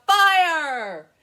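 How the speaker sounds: a full, high yell of fire inside the song; the big shouted word at the song's climax. Shown as a demonstration. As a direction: belt it out like a firefighter raising the alarm. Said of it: "hear me shout".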